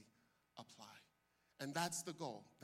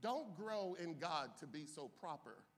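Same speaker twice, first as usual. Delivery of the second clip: ordinary talk, nothing marked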